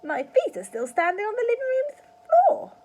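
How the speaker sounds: put-on high old-crone crackle; crackling old woman. A narrator's reading. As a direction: crackle it smugly. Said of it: "crackled the old woman".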